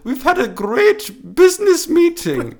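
very high, slow put-on Finnish accent, laughing; giggly hammed-up Finnish; mock Finnish accent. Finnish man praising the business meeting, in a mock Finnish accent. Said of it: "I'll be a fellow Finnish man".

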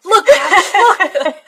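high, giggly excited pointing out; laughing look look. Real, not acted. Laughing co-host pointing out the screen, excited.

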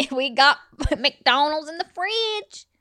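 put-on Southern accent. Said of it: "doing a Southern accent".

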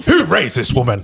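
lightly voiced outraged prude; a hint of a scandalised critic; put-on commenter voice. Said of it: "listen to the voice you're putting".

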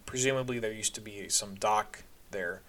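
plain reading voice

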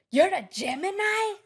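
loud, high mock yell in a Mexican accent; imitating his Mexican accent. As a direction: shout it like the old stylist in his Mexican accent. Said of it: "The accent".

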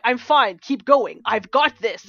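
loud stubborn bravado; a proud hiker insisting she's fine, loud; proud insistence. Retold or imitated. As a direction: push through, refusing help loudly. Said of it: "was like".

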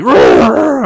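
high, loud bellowing bull roar; bull scream. Retold or imitated. Narrator imitating the calf's scream in the ring, high and loud. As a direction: let out the calf's huge scream.

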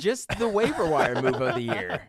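laughing. Real, not acted. Laughs at the bad pick.

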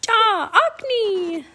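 high, loud, drawn-out bird squawk; raven croak. A narrator's reading. Caged raven calls to friends, high croaking cry. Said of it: "the raven croaked".

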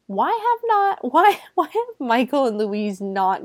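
sudden high-pitched disbelief; incredulous squeak. Real, not acted. Outraged disbelief at characters.

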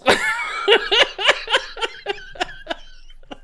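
high cackling laugh; high laughter. Real, not acted. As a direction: laugh in bursts. Cracking up.

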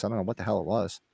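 ordinary delivery

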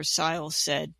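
plain reading voice

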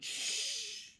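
long hissed shush; soft, sustained "shh" for silence. A narrator's reading. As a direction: hush them with a drawn-out shh. Hushing the others as seagull nears.

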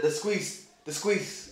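imitating a Jamaican accent to tease a partner. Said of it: "does the Jamaican thing of saying".

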